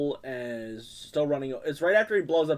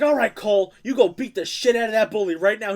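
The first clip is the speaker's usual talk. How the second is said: loud, higher pushy pep talk; egging-on dad; 7 semitones higher, 7 dB louder than usual. Retold or imitated. Dad urging Cole to fight the bully, loud and pushy. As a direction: fire the kid up to fight. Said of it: "He's like".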